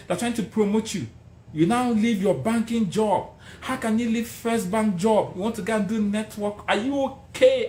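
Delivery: high, incredulous scolding voice; high mock outrage from friends; mocking critics. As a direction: mimic the doubters who scold you. Friends attacking his choice, voiced.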